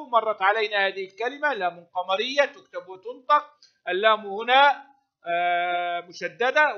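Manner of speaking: ordinary talk, nothing marked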